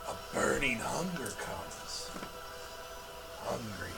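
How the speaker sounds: put-on raspy old hag. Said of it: "she says in a raspy voice".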